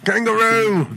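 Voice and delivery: loud, high Australian "Kangaroo"; hammed-up Aussie shout; Australian accent. Shown as a demonstration. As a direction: holler it like an Australian. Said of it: "You think Australians say kangaroo all the time?".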